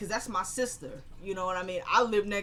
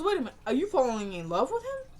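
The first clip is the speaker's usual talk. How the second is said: high-pitched acted disbelief; imitating a stunned, prying question; incredulous realization; 4 semitones higher than usual. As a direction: jump up high, end on a rising question. Acted startled question in retelling.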